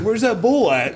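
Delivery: imitating the other hunter, high and loud; mock hunter voice. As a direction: pitch it up like the clueless caller. Other hunter calling out in story.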